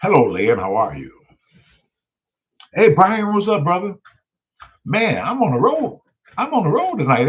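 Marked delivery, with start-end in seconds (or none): 2.7-3.9 loud, high hey to a buddy; excited greeting. Acted. Greeting his buddy Brian on the phone, loud and excited. 4.8-7.3 sing-song brag. Acted. Bragging to Brian about being on the road, high and lilting.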